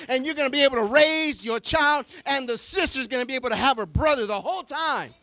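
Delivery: preacher building to a climax; preaching. Acted. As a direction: lift the congregation with rising fervor.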